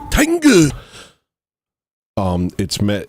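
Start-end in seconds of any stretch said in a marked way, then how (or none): 0.1-0.7 high, loud one-word cry; sudden shout. Acted.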